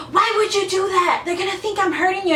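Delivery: high, mocking man's complaint; imitated scolding date. Date telling her off for yelling, high mocking imitation.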